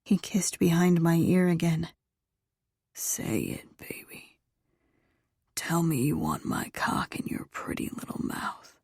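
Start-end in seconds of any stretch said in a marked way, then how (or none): 2.9-8.8 low, very soft whispered male voice; male lover, coaxing. A narrator's reading. Lover urging her to say the words, low coaxing whisper.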